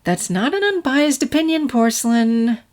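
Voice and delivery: big sister gently teasing. A narrator's reading. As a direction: tease her lightly. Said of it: "a more teasing tone".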